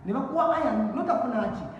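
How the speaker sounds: usual speaking voice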